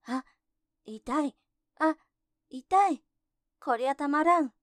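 ogre in pain. Acted. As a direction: yelp as if being stabbed inside. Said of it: "鬼は苦しがって".

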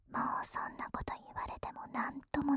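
whispered close to the ear; right in your ear. Acted. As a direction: lean in and whisper in his ear. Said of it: "男の子の耳元でささやく".

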